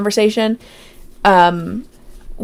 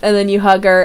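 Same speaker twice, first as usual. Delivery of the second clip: normal talking voice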